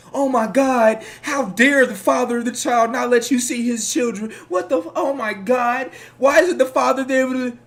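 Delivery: high, loud mocking gasp of outrage. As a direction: play the scandalized crowd, loudly. Mocking society's outraged reaction.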